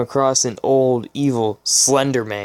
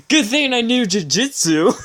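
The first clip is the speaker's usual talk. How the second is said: mocking delivery; 11 semitones higher than usual. Real, not acted. Mock reading of absurd line.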